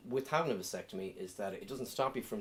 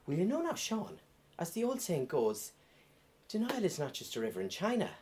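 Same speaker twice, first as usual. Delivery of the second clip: mum voice; 7 semitones higher than usual. Retold or imitated. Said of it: "this isn't even my real voice".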